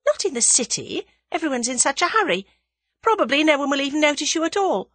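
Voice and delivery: put-on young woman character, dismissive. Young woman dismissing her friend's self-consciousness, brisk. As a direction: brush the worry aside briskly.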